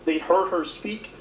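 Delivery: ordinary talk, nothing marked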